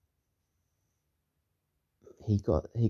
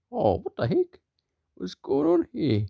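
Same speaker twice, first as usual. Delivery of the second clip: high-pitched acted bewilderment; high, rising "what's happening" voice; baffled character; 12 semitones higher than usual. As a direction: squeal it in mock confusion. Character baffled by stretching finger.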